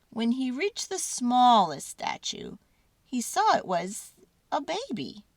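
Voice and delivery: plain reading voice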